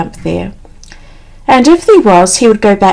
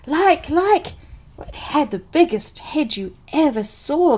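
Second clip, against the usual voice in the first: higher-pitched, faltering Piglet; flustered fright; 6 semitones higher, 7 dB quieter than usual. A narrator's reading. Piglet describing the Heffalump to Christopher Robin, flustered. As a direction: grope for words in mild panic.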